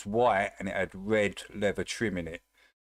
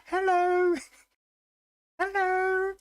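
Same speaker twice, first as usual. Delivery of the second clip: very high sing-song hello; cutesy pet greeting; 4 dB louder, slower than usual. Acted. Greeting the visiting cat in a very high cutesy voice.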